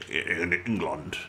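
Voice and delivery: put-on low, slow muddled accent on England; soft stab at some unknown accent; unidentifiable accent. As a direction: slip into an odd, low, unplaceable accent. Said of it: "I don't know what accent that was".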